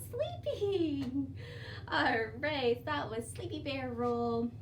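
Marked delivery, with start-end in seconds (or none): none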